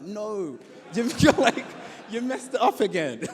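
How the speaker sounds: loud mock scolding that breaks into laughter; mock exasperated shout. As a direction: shout the no, then laugh through the rest. Exasperated at bad audition.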